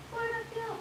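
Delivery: cutesy greeting. Real, not acted. Greets someone off camera.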